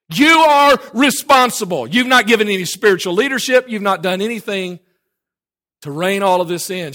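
high, booming rebuke, easing toward the end; shouted accusation. Acted. Prophet blames the king for failed leadership, shouted accusation. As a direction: hammer the blame home, starting at full shout.

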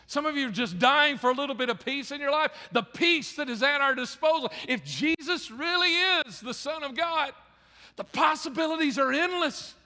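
high, insistent preacher's voice; impassioned preaching. Real, not acted. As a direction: preach it with rising fervor.

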